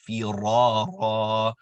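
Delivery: slow, loud firara, each ra held two counts; two count stretch. Shown as a demonstration. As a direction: hold both ra's in firara evenly. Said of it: "completing the basic stretch for two counts".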